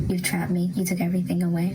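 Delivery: ordinary delivery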